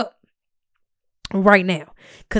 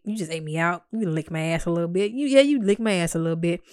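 ordinary delivery

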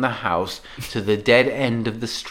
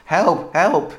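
understated cry for help, slightly raised; mild read-out yell; 4 semitones higher than usual. A narrator's reading. Narrator voices someone on the radio, mildly yelling for help. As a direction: call for help with only a touch of urgency. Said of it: "the voice on the other end yells".

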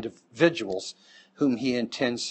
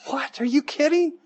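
much higher, incredulous "What? Are you kidding?"; mock shocked son; 14 semitones higher than usual. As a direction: play the son as a stunned, squeaky kid. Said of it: "And the son goes".

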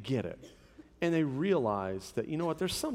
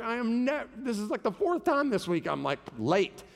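imitating a frazzled wife, higher-pitched; mildly acted exasperation, breaking off and restarting; frustrated, near crying; 7 semitones higher than usual. Husband imitating his wife late for the fourth time, frustrated. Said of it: "and she's frustrated".

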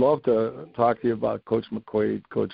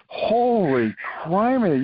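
amazed exclamation; 7 semitones higher, slower than usual. Real, not acted.

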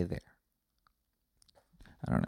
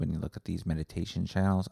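normal talking voice

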